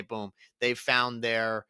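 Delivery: normal talking voice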